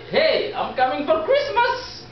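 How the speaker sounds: retold excited phone call to girlfriend; excited announcement. Calling girlfriend with big news.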